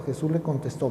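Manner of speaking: ordinary delivery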